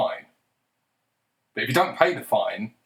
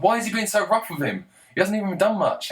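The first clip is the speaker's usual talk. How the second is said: high, whining mock outrage; mocking whiny critic; 9 semitones higher than usual. Mocking a critic who pities the arrested man, in a high whine. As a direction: mimic a naive bleeding-heart critic, pitched way up.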